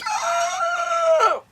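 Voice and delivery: high, loud mock death cry; old lady scream. The old lady collapsing in the van, high loud yelp. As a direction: let out a short strangled old-woman yelp. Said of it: "the old lady's like".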